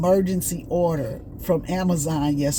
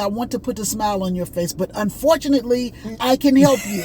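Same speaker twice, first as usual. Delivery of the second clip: put-on light Indian accent; 2 semitones higher, 4 dB louder than usual. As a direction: imitate the call center agent lightly. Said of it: "I'm trying to talk to y'all at the way he was talking".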